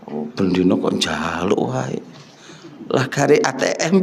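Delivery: low, soft grumble of annoyance; grumbling giver. Acted. Preacher voicing a stingy giver's grumble, low muttered complaint. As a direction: mutter the complaint under your breath.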